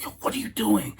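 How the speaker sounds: high, hushed imitation of a scandalized wife; shocked wife. Imitating wife checking husband's rude blunder, shocked.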